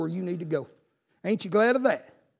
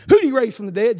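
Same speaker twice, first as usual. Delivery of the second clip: ordinary talk, nothing marked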